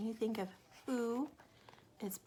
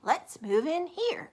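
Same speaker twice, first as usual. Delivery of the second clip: Mister Bird voice; 8 semitones higher, 11 dB louder, slower than usual. Acted. Mister Bird suggests house.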